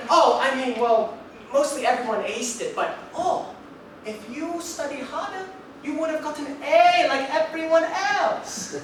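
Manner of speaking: high-pitched lecturing dad; father scolding son to study harder; a hint of dad. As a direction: chide the son lightly, up high. Dad's reply voiced.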